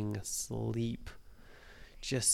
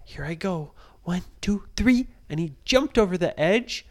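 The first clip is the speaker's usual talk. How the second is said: excited; 6 semitones higher, 9 dB louder than usual. A narrator's reading. Jump countdown climax.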